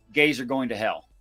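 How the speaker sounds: ordinary talk, nothing marked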